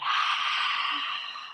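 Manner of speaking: soft breathy sigh out; long exhale sigh. Shown as a demonstration. Teacher shows the children a long exhale. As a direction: let the breath go slowly. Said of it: "And exhale, let it out".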